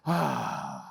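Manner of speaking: low long exhale; big sigh. Shown as a demonstration. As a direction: let out a big deep sigh. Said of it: "let out a big sigh".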